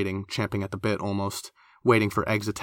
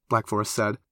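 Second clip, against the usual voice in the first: usual speaking voice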